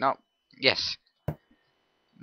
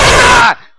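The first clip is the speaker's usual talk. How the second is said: battle yell; 11 semitones higher, 17 dB louder than usual. Acted. Battle cry.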